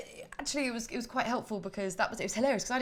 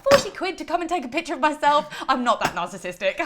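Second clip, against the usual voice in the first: loud, high incredulous protest; hammed-up indignant disbelief; mock outrage; 8 semitones higher, 12 dB louder than usual. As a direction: balk at the price. Mock outrage at ticket price.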